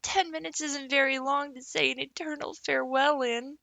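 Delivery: high, a touch tearful and dramatic; a girl savouring her sorrow; lightly tearful farewell. A narrator's reading. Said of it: "said Anne tearfully".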